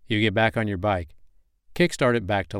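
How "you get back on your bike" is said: plain reading voice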